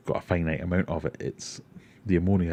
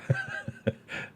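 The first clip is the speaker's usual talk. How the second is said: short breathy snort of a laugh; a quick laugh held back; a muffled laugh he tries to hold in; stifled laugh; 4 dB quieter than usual. Real, not acted. Laughing at a rival product.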